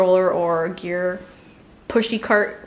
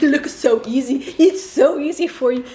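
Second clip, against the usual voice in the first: mock envy; 3 semitones higher, 4 dB louder than usual. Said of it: "I'm like".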